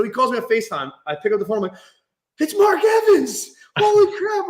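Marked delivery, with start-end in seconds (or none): 2.4-4.5 high, loud, drawn-out retold amazement; starstruck yell. Starstruck at seeing famous caller.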